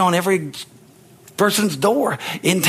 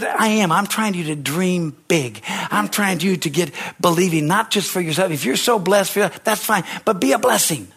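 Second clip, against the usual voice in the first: normal talking voice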